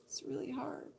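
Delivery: hushed, breathy aside; soft whispered grief. Real, not acted. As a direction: whisper it under the sorrow. Whispered after grief story.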